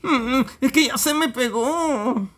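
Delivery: slow, high comic character voice; high drawled excuse; Afelandro imitation. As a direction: answer in the same high, drawn-out put-on voice. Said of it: "imitando la voz de Afelandro".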